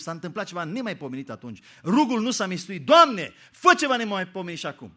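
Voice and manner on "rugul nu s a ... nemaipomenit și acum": bold plea. Acted.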